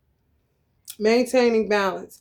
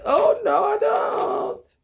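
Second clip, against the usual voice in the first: mock horrified; 12 semitones higher, slower than usual. Mock refusal, high cry.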